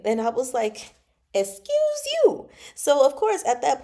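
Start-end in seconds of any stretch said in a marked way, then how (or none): 1.3-2.5 high, drawn-out affronted retort; mock offence. Reader retorting to a pushy suitor, offended. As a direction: squeal it slowly, appalled.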